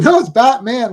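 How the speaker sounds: retold exasperated kid's correction; upset kid correcting. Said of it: "the kid was getting upset".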